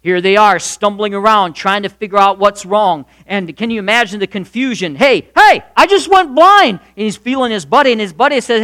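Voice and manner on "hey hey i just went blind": much higher, louder startled cry; sudden frightened shock; panicked. Acted. Blinded soldier crying out to his buddy, panicked.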